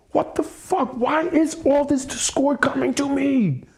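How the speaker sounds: high, despairing exasperation; exasperated cry. Real, not acted. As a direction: cry out to the universe. Ranting about discord.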